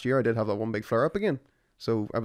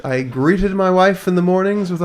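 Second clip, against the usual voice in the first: higher, louder, sugary greeting; mock sweet husband; 8 semitones higher, 9 dB louder than usual. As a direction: ham up the loving husband. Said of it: "you were a ray of sunlight".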